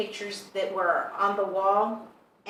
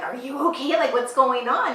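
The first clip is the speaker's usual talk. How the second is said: higher and louder, a startled shout; alarmed call; 6 semitones higher, 8 dB louder than usual. Retold or imitated. Checking on a client who jumped up screaming, alarmed shout.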